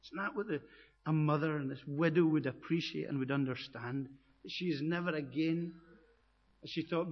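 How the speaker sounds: usual speaking voice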